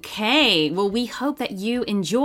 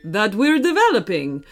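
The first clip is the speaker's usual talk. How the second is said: hearty video game hero, put-on voice; Mario. Mario welcomes Archie into the game, hearty character voice. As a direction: welcome them in a lilting cartoon hero voice.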